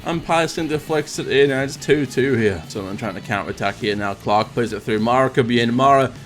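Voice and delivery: football commentary, rising with each chance; match commentator calling the action; live play-by-play. Acted.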